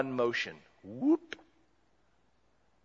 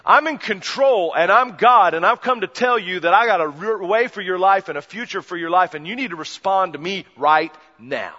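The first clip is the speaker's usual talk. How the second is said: loud insistent preaching voice; forceful preaching; 4 semitones higher, 9 dB louder than usual. Acted. Preacher voicing Jesus to the listeners, loud and insistent. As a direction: press it home on them. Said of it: "Jesus says".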